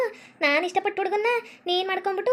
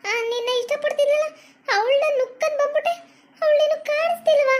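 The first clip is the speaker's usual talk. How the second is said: terrified calling out; 7 semitones higher than usual. Acted. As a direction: scream for him, the ghost is right there. Wife sees ghost, calls husband.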